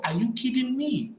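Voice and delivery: normal talking voice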